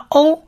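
O held as a long vowel, pitched high. Shown as a demonstration. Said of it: "就是发长母音O的这个字". (示范长母音O，音调抬高)

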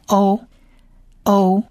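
slow, clear long "O" sounded out three times; teacher drilling the long "O" vowel slowly; drawn-out O sounds. Shown as a demonstration. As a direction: model the long "O" slowly for students. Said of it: "所以这个字就念O".

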